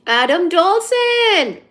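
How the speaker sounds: high, loud, slow name call. Acted. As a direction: sing out the name slowly. Calling out a name.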